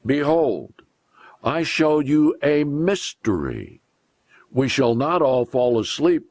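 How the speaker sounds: ordinary talk, nothing marked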